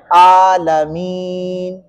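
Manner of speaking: عالمین very slow, "aa" and "ee" both held; عالمین chanted out on long level vowels; long final vowel. Shown as a demonstration. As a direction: stretch the "meen" of عالمین as long as its "aa". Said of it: "کھڑی زیر کی طرح لمبا کریں".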